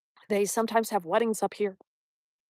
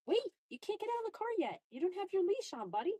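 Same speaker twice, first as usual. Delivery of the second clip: gentle dog talk; 8 semitones higher, 9 dB quieter, faster than usual. Real, not acted.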